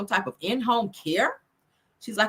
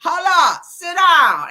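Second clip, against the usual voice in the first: loud callout; 8 semitones higher, 9 dB louder, much slower than usual. Retold or imitated. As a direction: bark it out like a rap hook. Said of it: "Ain't that what [name] said?".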